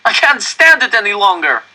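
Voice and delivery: sudden loud, high yell. A narrator's reading. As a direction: burst out yelling in despair. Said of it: "yelled at last".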